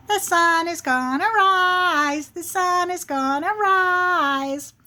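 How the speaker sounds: high, drawn-out singsong taunt; teasing little squirrel chant; smart-alecky squirrel. Acted. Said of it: "the little squirrel".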